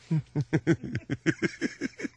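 soft, drawn-out chuckling; laugh. Real, not acted. Host amused by his own failed ploy, chuckling. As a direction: chuckle softly for a couple of seconds.

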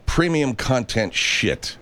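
slow, heavy weight on "shit" at the end; stress on shit. Shown as a demonstration. Host underlines the word shit for listeners, slow and weighty. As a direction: lean hard on "premium content shit", drawing out "shit". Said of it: "let me underline the word shit".

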